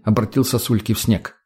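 plain reading voice

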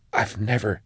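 soft, stunned murmur. A narrator's reading. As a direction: murmur it half to yourself. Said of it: "she murmured, pacing".

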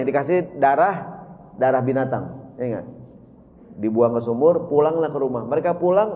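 ordinary delivery

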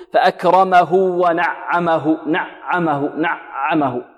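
"نعمه" with the ع choked off, sound stopped short; ayn too hard. Shown as a demonstration. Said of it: "ربما ينطقها فيها شدة".

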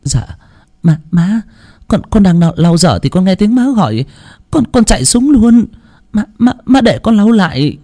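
anxious explanation. A narrator's reading. Daughter-in-law stammers her excuse to the mother-in-law. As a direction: explain meekly, tripping on words. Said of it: "Nguyệt chạy lên thanh minh".

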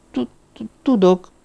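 high, stuttering groan of fear; frightened stammer. A narrator's reading. The frightened girl stammers to Lamartine, groaning in fear. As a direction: stumble over the word, terrified. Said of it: "nyögte a lány".

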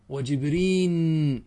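وجبرين said slowly as wajibrin, ending in n. Shown as a demonstration. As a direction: stretch وجبرين and close it on an n: wajibrin. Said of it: "بالنون". (وجبرين تُنطق ببطء بالنون في آخرها)

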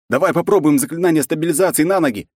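high, loud shout over the quake. Acted. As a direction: shout to a friend over noise. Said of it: "крикнул Эду".

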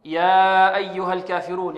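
"يا أيها الكافرون" recited with an over-held يا; over-long four-count madd. Retold or imitated. Teacher imitating many students, four-count elongation of يا. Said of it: "بمقدار أربع حركات أو ثلاث حركات".

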